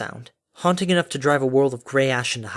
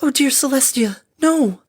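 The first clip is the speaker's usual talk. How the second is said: very high, loud horrified cry; gasping shriek; 12 semitones higher, 5 dB louder than usual. A narrator's reading. Fluttershy sees what the blast hit, shrieking. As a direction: cry out high in horror, rising louder. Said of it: "Fluttershy's gasping shriek".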